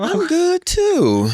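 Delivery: deflated, depleted hoarse voice; worn-out voice from yelling all night; weak hoarse voice. Real, not acted. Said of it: "your weak voice".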